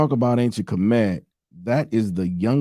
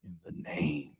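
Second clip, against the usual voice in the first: slow preacher intonation; 9 dB quieter than usual. Pretend. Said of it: "I gotta say it like a demon, though".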